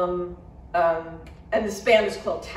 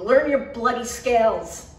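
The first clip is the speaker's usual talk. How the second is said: slow, louder angry jab; 4 semitones higher, 8 dB louder than usual. A narrator's reading. Garrett berating Johnny in a studio quarrel, angry. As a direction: spit out each word.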